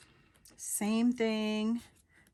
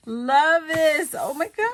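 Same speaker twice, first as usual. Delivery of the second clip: excited gushing; 8 semitones higher, 10 dB louder than usual. Real, not acted. Gushing over paper pad.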